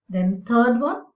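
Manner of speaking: ordinary delivery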